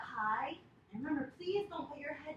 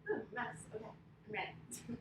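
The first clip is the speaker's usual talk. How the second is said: normal talking voice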